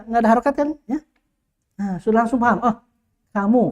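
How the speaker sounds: normal talking voice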